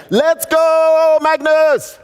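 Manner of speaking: high, loud, sustained holler; big drawn-out shout; rallying yell. Retold or imitated. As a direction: yell it out like a war cry to fire up a teammate. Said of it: "call Magnus and yell".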